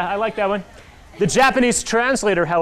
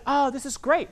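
higher, eager put-on editor voice; editor's eager voice; 7 semitones higher than usual. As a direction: gush a little like the keen editor. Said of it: "and Jennifer said".

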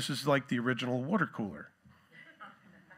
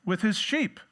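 offhand shepherds; 6 semitones higher, 4 dB louder than usual. Retold or imitated. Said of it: "all of a sudden they goes".

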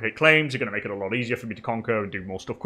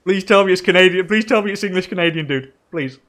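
loud, higher, hammed-up begging; mock pleading; 7 semitones higher, 6 dB louder than usual. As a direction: beg your friend in jest. Begging friend to be English Canadian.

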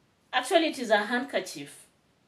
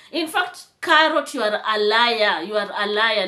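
calling out Carrot as a liar, furious; angry accusation; 7 dB louder than usual. Real, not acted. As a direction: accuse her with full force. Angrily accusing rival of lying.

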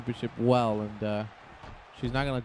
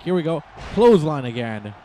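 louder, fed-up mock groan; exasperated retort; 8 dB louder than usual. Commentator voicing Naomi fed up with another clothesline, louder. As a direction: throw it out like she's sick of it. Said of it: "Naomi's like".

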